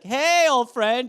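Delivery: high, drawn-out greeting; stretched mock-jolly hail; awkward false heartiness. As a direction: overdo a friendly hello, slow and high.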